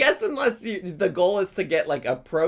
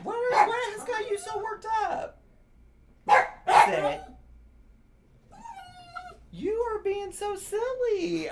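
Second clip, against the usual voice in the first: put-on much higher coaxing baby talk to a dog; high pet voice; 14 semitones higher than usual. Streamer coaxing her barking dog in high pet voice. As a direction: coo at a nervous pup, pitch way up.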